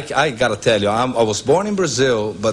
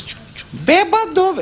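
very high squeaky taunting call of bêbado; shrill-pitched mocking snake calling out. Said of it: "The snake goes".

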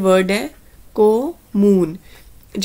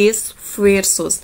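each sound drawn out slowly; very slow Spanish word for learners; slow clear demo; much slower than usual. Shown as a demonstration. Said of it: "pronunciation ਦੇ ਉੱਪਰ ਧਿਆਨ ਦੇਣਾ". (ਹੌਲੀ ਹੌਲੀ ਸਾਫ਼ ਉਚਾਰਨ)

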